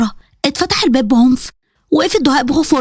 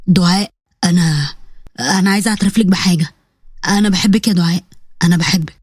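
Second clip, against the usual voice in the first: shy confession; 8 semitones lower than usual. A narrator's reading. Yasin confessing his love to Doaa, low and halting. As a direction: say it lower, hesitating over each word.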